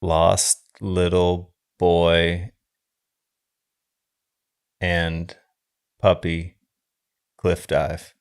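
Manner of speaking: slow, deadpan pitch; slow mock-grim teaser; bad synopsis pitch. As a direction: lay out each word slowly. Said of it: "Let's see your other one".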